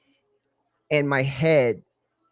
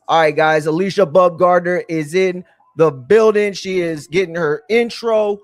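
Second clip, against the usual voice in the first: announcer hype; 7 dB louder than usual. Acted. Streamer announcing to chat the fighter's entrance, loud announcer hype. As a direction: announce her like she's in the building.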